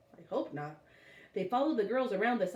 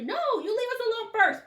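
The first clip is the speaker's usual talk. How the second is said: put-on much higher, louder kid's shout; bratty shout; 7 dB louder than usual. A narrator's reading. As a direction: yell it back like a sulky boy. Bradley quarrelling.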